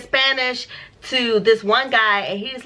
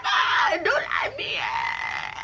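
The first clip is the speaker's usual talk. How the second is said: high, slow, half-laughing wail; mock wail; 12 semitones higher, slower than usual. Pretending to beg the cops, high half-laughing wail.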